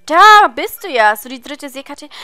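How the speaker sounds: excited game character. Acted. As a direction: burst in loudly with eager news. Reads game dialogue aloud as a character.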